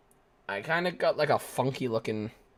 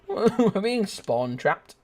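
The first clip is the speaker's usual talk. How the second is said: laughing slowly; 6 semitones higher, much slower than usual. Real, not acted. Laughing after death.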